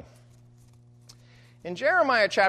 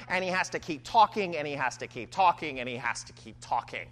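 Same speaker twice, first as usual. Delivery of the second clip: each repeat softer and lower, winding down; fading repetition; 4 semitones lower, 3 dB quieter than usual. Acted. Preacher stressing Jeremiah's endless compulsion, fading each time. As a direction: let the phrase die away with each repeat.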